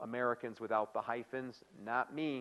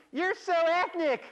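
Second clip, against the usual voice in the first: very high, loud, drawn-out mockery; exaggerated gushing, pitched sky-high; put-on coworker accent; 8 dB louder, slower than usual. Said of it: "as opposed to".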